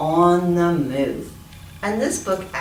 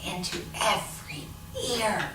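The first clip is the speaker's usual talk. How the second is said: hushed; 10 dB quieter than usual. A narrator's reading. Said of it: "she whispered her plan".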